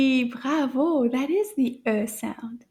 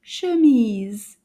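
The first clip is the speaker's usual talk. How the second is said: "Chemise" joined up quickly and louder; a little faster; 6 dB louder than usual. Shown as a demonstration. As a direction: run both syllables of "Chemise" together, louder. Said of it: "say it again a little faster".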